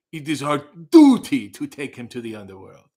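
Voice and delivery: loud, stagey epic declaiming; Ten Commandments-style acting. Performing Egyptian for a paying viewer, loud declaiming. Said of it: "just seeing the Ten Commandments and going".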